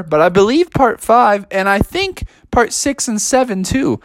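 high-pitched kangaroo character; silly voice. Acted. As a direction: defend yourself in a high silly voice. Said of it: "he was just doing a silly voice".

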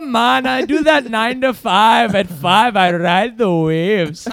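high, loud, slow surfer dude; surfer bro voice. Pretend. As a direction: crow it like a beach bum. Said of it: "I'll try mine".

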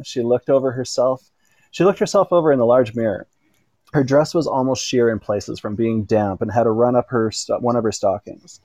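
ordinary delivery, nothing marked

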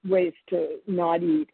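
ordinary delivery

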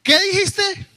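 high, loud outraged question; indignant challenge. Retold or imitated. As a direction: dare the kid to repeat it. Parent challenging child's defiance.

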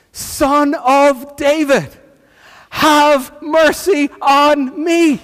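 slow, high cry for mercy; shouting. Retold or imitated. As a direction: call out insistently, stretching each word. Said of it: "And he shouts".

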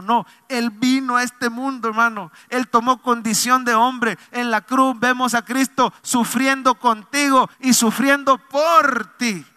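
fervent sermon climax; preaching. Acted. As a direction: drive it home to the church. Preaching about Christ on cross.

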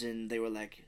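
ordinary talk

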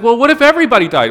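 high, loud, fast outburst; exasperated child. Acted. Child pressing parent with the biggest what-if, loudly frantic. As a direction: blurt it out like a worried kid at full volume.

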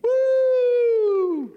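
long, loud high woo; high victory cheer; cheering whoop. A narrator's reading. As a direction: whoop in triumph. Cheers the freed trucks.

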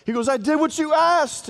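high, bright offering; eager son. Retold or imitated. Esau bringing the meal to his father, high and eager. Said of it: "brings it to his dad".

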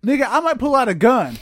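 high, loud mock threat; acted threat. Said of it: "Bust means like".

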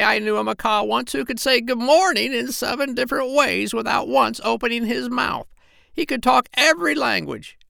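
put-on very high, indignant old parrot voice; a scornful old parrot grumbling way up high; the parrot scoffing at people to the doctor, very high; parrot Polynesia. Parrot's long complaint about people.

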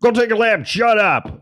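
high-pitched mock coach holler; hammed-up football coach shout; imitated coach yelling. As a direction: yell orders at a player, exaggerated. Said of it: "they yelled at you".

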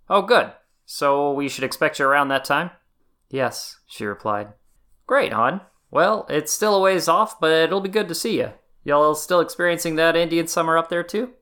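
bright folksy country dad; folksy father. A narrator's reading. Father voiced, folksy drawl.

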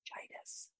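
hoarse whisper of a lost voice; barely any voice left, just breath. Retold or imitated. Telling his producer he has laryngitis, whispered. Losing voice before broadcast.

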